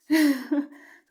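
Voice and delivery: short burst of laughter; loud bright laugh. Real, not acted. Laughing at the pets' chase.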